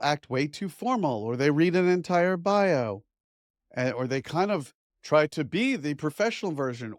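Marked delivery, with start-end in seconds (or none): none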